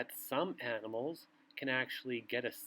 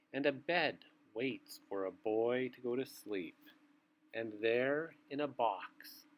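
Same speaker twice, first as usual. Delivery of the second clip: bedtime story reading; 3 semitones lower than usual. A narrator's reading. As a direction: read it like a bedtime book to little ones. Reads bedtime picture book aloud.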